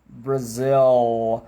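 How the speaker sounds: very slow I'm from Brazil., Brazil. with long final L; stretched English demo. Shown as a demonstration. Teacher exaggerating for students, very slow. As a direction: drag Brazil. out to show the dark L. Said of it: "na fala rápida eles não esticam tanto".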